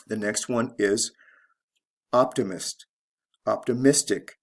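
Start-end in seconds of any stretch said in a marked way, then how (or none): 2.1-2.8 "optimist" stressed on OP; teacher's model of "optimist", beat on OP; stress on first syllable. Shown as a demonstration. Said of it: "from the first syllable". 3.5-4.3 "optimistic" stressed on MIS; teacher's model of "optimistic", beat on MIS; stress on third syllable. Shown as a demonstration. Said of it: "to the third syllable".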